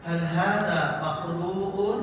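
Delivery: slow, loud model phrase; slow loud Arabic drill. Shown as a demonstration. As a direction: drag it out clearly for repetition.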